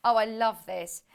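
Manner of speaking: ordinary talk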